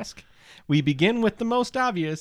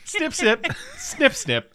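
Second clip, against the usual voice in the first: high squeaky snip, snip; high mock snip voice; 13 semitones higher, slower than usual. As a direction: play the creepy snipper, high-pitched. Mimicking the episode's snip.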